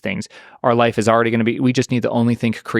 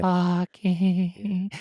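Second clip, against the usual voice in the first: high, thin mock lady voice; imitating the French lady voice; 8 semitones higher, 4 dB quieter than usual. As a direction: squeak it like a glitchy lady. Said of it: "multilingual French lady".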